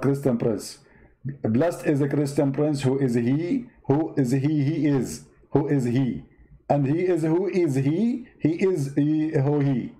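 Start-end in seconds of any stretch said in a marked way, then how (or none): none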